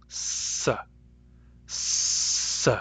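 S and s as a voiceless alveolar hiss; s sound demo. Shown as a demonstration. Teacher demonstrating the S spirant to students, soft hiss. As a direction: hiss the s softly. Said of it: "the S sound".

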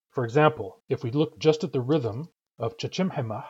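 normal talking voice

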